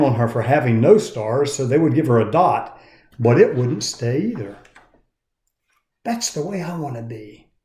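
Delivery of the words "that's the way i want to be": thought aloud. A narrator's reading. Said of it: "thought Punchinella".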